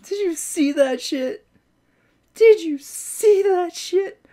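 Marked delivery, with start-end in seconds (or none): 2.3-4.2 slower, higher, louder amazed repeat; high, slow astonished repeat; excited. Real, not acted. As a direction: stretch it out in disbelief. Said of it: "repeated more intensely".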